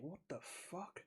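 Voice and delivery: slow, hushed, drawn-out disbelief; stunned disbelief. Retold or imitated. Gamer dying on the remastered level, hushed slow disbelief. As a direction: let it fall out of you in slow shock. Baffled by a harder game.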